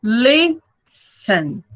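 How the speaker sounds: Listen broken slowly into two beats; "Listen" syllable by syllable, with a gap between; two separate syllables. Shown as a demonstration. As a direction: split "Listen" into its syllables, first one strong. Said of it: "Tem duas sílabas".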